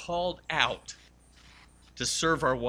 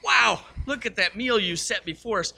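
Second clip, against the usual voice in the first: higher, louder gushing husband; gushing praise; 7 semitones higher, 5 dB louder than usual. Retold or imitated. Joe to his wife over dinner, higher and louder gushing. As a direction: lay on the admiration, higher. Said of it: "and Joe said".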